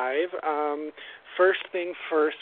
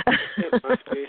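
mock ominous; 8 semitones higher, 3 dB louder, slower than usual. Joking about Jupiter.

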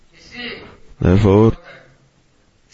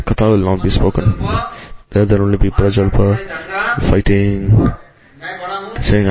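ordinary delivery

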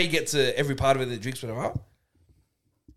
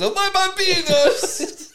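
very high, slow, drawn-out cooing with a laugh; mock dog owner coo; 4 dB louder, much slower than usual. Imitating Ray cooing at his dogs, very high slow coo. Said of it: "It's like".

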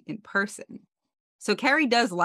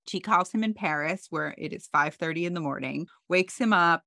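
ordinary talk, nothing marked

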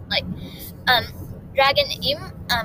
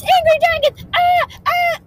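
very high, loud shriek; panicked scream; 8 dB louder than usual. Acted. Rat hearing about angry dragons, loud high screaming. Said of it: "screaming very loudly".

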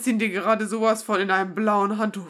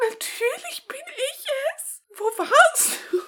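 high emotional character voice; 11 semitones higher, 3 dB louder than usual. Pretend. Voicing reunited character.

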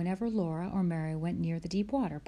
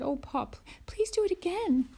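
much higher, louder eager plea; eager little girl; 12 semitones higher, 4 dB louder than usual. Acted. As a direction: beg Pa for another ducking. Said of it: "Laura sputtered".

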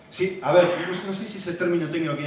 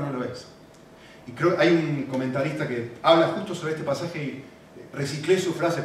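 ordinary talk, nothing marked

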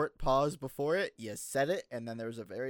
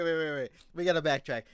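ordinary talk

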